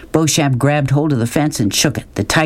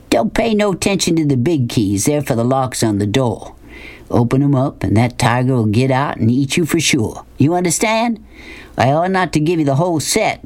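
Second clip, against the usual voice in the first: drawling Southern schemer; 3 semitones higher than usual. Acted. Man handing cage keys to a boy, drawled warning. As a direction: instruct the boy like a Southern boss. Narrator voices Beauchamp.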